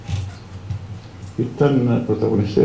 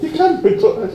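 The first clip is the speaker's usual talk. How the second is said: panicked; 3 dB louder than usual. Retold or imitated. Panicked boss.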